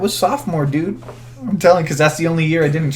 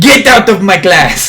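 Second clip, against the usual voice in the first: high, loud, slow mock French outrage; poor French accent; 6 semitones higher, 11 dB louder, slower than usual. Imitated teacher throwing students out, poor French accent. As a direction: yell it in a badly faked French accent. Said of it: "trying to do very poor French accent".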